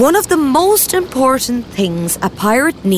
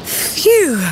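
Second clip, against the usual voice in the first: relieved puff; 8 semitones higher than usual. Acted. After climbing the steps.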